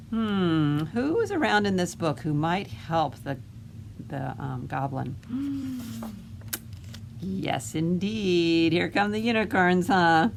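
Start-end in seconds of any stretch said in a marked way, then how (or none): 5.2-7.2 soft, breathy gasp of surprise; delighted gasp. Acted. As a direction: gasp as the heroes arrive. Unicorns arrive to help.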